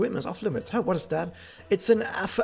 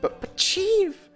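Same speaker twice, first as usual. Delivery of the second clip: very high, slow, squeaky plea; a flustered underling begging the chief; panicked protest; 4 dB louder, much slower than usual. Acted.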